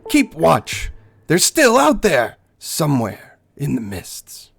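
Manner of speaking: irritated elf warrior; annoyed ranger. Acted. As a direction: hush the kid and keep watch. Ranger scolds the boy.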